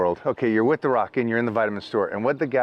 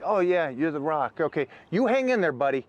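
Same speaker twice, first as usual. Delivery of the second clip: mock cheerful clerk; 7 semitones higher than usual. Mocking clerk encouraging The Rock.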